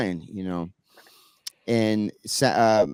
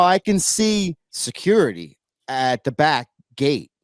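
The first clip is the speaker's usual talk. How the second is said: normal talking voice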